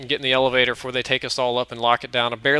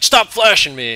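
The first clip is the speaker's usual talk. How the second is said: put-on loud, higher, angry old man snapping; old man's mock yell; 6 semitones higher, 8 dB louder than usual. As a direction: bark it like a grumpy old man. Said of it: "some old guy in the back gets mad".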